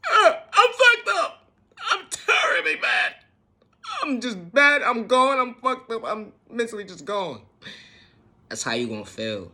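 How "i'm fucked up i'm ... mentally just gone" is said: high, hammed-up whiny wail; mock sobbing. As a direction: overdo the breakdown with a cracking cry. Acting out emotional breakdown.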